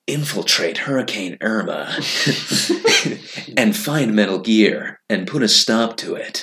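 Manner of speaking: soft, bassy Solid Snake voice. Acted. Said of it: "I love doing the Snake voice".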